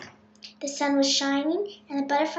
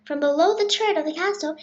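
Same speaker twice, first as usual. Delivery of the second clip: plain reading voice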